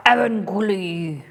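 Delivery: Avancully drawn out slowly as EH-vun-koo-lee with a put-on foreign accent; slow, theatrical accented Avancully. Shown as a demonstration. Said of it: "what accent I should put on".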